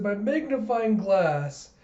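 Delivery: put-on high, slow little-kid voice; high, stretched-out character exclamation; Anya. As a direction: draw it out in a high child's voice. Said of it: "And Anya's like".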